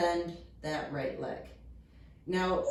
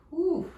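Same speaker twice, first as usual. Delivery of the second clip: whew exhale; 10 semitones higher, 3 dB louder than usual. Real, not acted. Exhaling after hamstring stretch.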